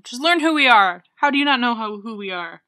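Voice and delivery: loud, playful scolding; mock indignation. Co-host scolds listeners who don't know them, loud mock outrage.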